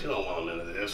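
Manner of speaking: low, quiet imitation of a raspy talker; Harry's raspy voice. Retelling a dancer's challenge at a battle, low raspy imitation. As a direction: drop low and soft, imitating his rasp. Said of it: "He was always talking like that".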